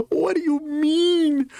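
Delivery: stretched disbelief. Real, not acted. Repeating it, he drags out a high, outraged question. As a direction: drag the question out, still squeaking.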